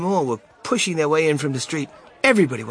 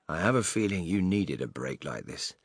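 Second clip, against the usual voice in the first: smiling, kindly; 5 semitones lower, 4 dB quieter than usual. Acted. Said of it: "smiling at Charlie".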